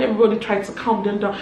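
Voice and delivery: ordinary talk, nothing marked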